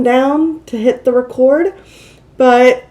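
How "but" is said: ordinary talk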